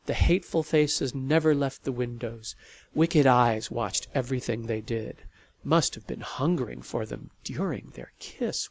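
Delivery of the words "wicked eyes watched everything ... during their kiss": ordinary delivery, nothing marked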